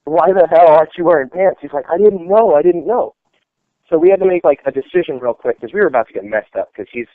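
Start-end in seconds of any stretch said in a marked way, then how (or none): 0.0-1.6 high, loud disbelieving questions; incredulous retold yelling; exasperated. 1.9-3.1 high, loud pleading whine; mock panicked excuse; helpless whine. Lamb's helpless answer.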